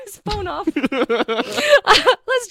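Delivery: cracking up; breaking character with laughter; real giggles through the words; laughing. Real, not acted.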